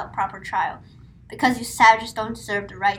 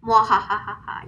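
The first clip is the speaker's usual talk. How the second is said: evil villain laugh. Acted.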